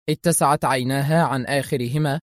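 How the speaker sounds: plain reading voice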